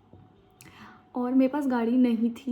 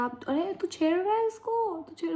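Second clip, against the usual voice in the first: high-pitched mock cop scolding; imitating policeman; 7 semitones higher than usual. Policeman scolds harasser on train, high mock scolding. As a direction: scold the man like a stern cop.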